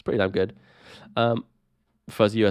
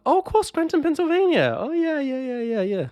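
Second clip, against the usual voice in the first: excited realization. Real, not acted. Excited Office recognition.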